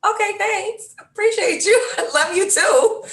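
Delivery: bashful daughter laughing off the compliment; high girlish laughing. Retold or imitated. Daughter laughing shyly as her dad says he loves her. Daughter brushing off dad's affection.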